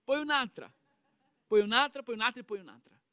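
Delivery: conteggio enfatico. Acted. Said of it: "sto contando, eh?".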